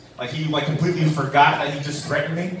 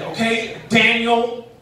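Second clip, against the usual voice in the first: higher, louder strained-patience mom voice; exasperated mom; 7 semitones higher, 3 dB louder than usual. Retold or imitated. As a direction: mom pleading through gritted teeth. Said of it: "she's like".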